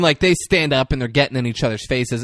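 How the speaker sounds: ordinary delivery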